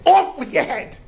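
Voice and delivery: high, loud royal command; angry lion king. Acted. Lion king condemning the wolf, angry command. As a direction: pronounce the sentence high and loud. Lion orders wolf's death.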